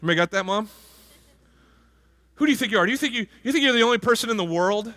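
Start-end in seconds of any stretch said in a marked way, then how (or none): 2.3-4.9 higher-pitched mock mom scolding a kid. Preacher imitates a mom scolding her child, mock scold. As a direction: play the nagging mother, exasperated. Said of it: "that mom who every time you got in trouble".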